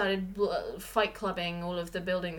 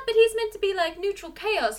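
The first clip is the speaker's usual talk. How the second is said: mock indignant; 10 semitones higher, 4 dB louder than usual. Retelling her reaction to the twist, high mock indignation. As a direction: protest like the plot cheated you. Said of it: "I was so busy being like".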